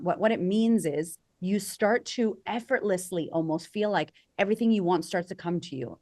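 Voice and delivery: ordinary talk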